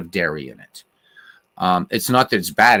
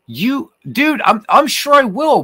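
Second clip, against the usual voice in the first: excited protest; 8 dB louder than usual. Real, not acted. Reacting to a chat comment.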